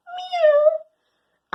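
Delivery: high-pitched cat meow. Acted. Dog narrator imitates the cat.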